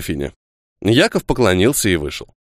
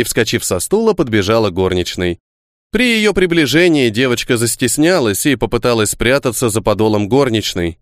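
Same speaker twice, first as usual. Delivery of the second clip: plain reading voice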